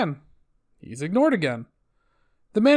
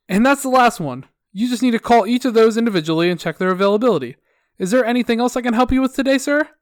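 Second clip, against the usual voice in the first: higher, louder customer service sing-off; sickeningly positive; 5 semitones higher, 5 dB louder than usual. Acted. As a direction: wrap up with relentless fake cheer. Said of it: "my sickeningly positive customer service attitude".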